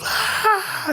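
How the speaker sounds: short high imitated scream. Wife screams as her leg is cut, high mock shriek. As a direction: throw out a sudden shriek, mock pain. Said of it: "she goes".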